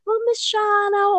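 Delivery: put-on high, childlike plea; child voice. Child calling the teacher, high and childlike. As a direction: pipe up like a little kid. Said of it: "They're like".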